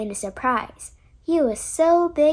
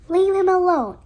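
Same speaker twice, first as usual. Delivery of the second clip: higher, louder protective cry; 5 semitones higher, 5 dB louder than usual. A narrator's reading. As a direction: shield her chick with a sharp cry. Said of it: "cried the mother".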